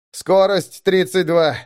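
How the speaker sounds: plain reading voice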